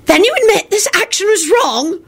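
high-pitched, ringing crone voice; raspy old witch. A narrator's reading. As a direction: ring out like an ancient tiny witch. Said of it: "rang out the raspy voice".

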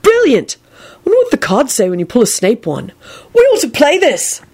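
put-on high, eager young boy's voice; a grown man sounding about eight; boyish Neville. A narrator's reading. Neville gushing to Elizabeth over the cards, high and boyish. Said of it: "Neville sounded as if he were about eight years old".

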